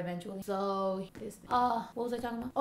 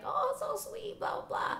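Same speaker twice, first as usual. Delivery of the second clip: put-on high, soft cooing old lady; fading into a softer mumble; 12 semitones higher, 5 dB quieter than usual. Old lady gushing over the dog, high and soft. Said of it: "And then she was like".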